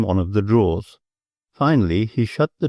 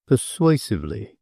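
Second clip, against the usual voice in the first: ordinary delivery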